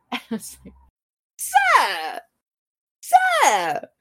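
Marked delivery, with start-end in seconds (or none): 1.4-3.9 high, drawn-out theatrical protest; two separate cries of shocked indignation; mock affronted. Reacting as Lady Anne spits at Richard, mock affronted.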